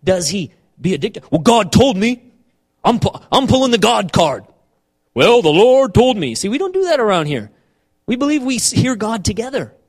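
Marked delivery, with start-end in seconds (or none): 1.3-6.3 loud, pompous God-card boast; hammed-up holy authority; mock self-righteous. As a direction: pull rank with mock piety, loudly. Mocking those who pull God card.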